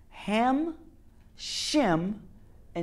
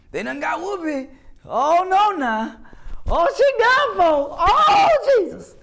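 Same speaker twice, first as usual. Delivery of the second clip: very high mock wail, building to a loud shout; hammed-up caricature, crying out in exaggerated alarm; "house Negro" voice; 11 dB louder, slower than usual. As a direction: escalate a high-pitched caricature into full-blown hollering. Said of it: "house Negro voice".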